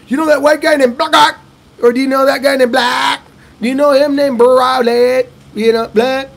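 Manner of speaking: high, loud mock of locals garbling Brad; mangling the name. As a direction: blurt the wrong names eagerly. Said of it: "they all pronounce it differently".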